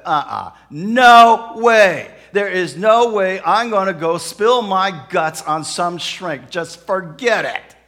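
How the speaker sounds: acted angry outburst, stretched NO WAY; indignant refusal. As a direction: dig in and refuse at full volume. Husband flatly refuses counseling.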